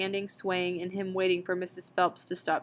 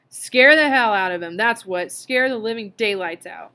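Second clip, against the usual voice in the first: defiant shout; 2 semitones higher, 10 dB louder than usual. A narrator's reading. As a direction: blast it out in defiance. Montag shouts defiance to Faber.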